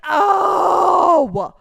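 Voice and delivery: long, loud groan of exasperation; upset groan. Real, not acted. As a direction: let out a high, drawn-out frustrated "oh". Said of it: "I wouldn't get that upset".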